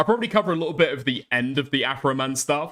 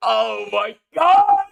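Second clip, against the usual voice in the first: laughing outcry; 8 semitones higher, 7 dB louder, much slower than usual. Real, not acted. Overwhelmed by laughter at clip.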